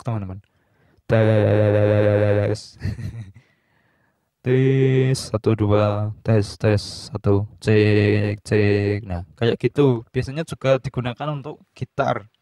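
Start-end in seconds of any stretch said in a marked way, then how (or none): 1.1-9.0 flanger effect. Shown as a demonstration. Mic test for viewers through a flanger effect. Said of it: "Flanger itu suara kita seperti diulek-ulek".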